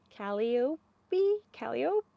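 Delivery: Calliope said uncertainly as KAL-ee-oh, final syllable dropped; unsure mispronunciation. Real, not acted. Knitter guessing a yarn colorway's name, Calliope wrongly as KAL-ee-oh. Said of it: "I'm gonna say it wrong".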